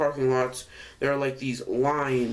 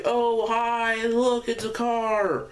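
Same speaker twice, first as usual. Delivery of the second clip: high, drawn-out mock cheer; excited kids' greeting; 9 semitones higher, slower than usual. Kids at recess spot a passing car, high mock-excited greeting. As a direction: imitate kids hailing a car, high and slow.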